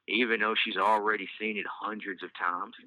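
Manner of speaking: ordinary delivery, nothing marked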